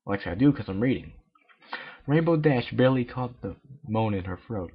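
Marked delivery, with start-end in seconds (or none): none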